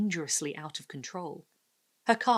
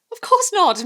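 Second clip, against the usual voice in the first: hasty reassurance; 14 dB louder than usual. A narrator's reading. Hasty reassurance to new neighbour.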